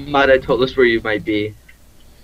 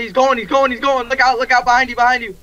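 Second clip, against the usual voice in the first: high, urgent warning calls; alarm shout; 9 semitones higher than usual. Real, not acted. Alarmed warning during fight.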